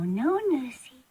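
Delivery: ordinary talk, nothing marked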